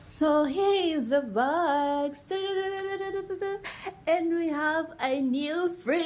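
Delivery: high, stretched-out announcement; excited reveal. Real, not acted. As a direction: unveil it with a drawn-out, joyful call. Big reveal of the new fridge.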